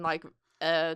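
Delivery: ordinary talk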